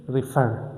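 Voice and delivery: refer with the second syllable stressed, re-FER; light re, weight on fer in refer. Shown as a demonstration. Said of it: "My stress is on fer".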